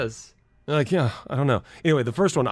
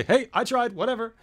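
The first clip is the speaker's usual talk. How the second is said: dismissive; 11 semitones higher than usual. Retold or imitated. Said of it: "I can just say".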